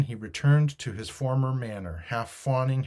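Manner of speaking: plain reading voice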